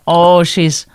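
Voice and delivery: normal talking voice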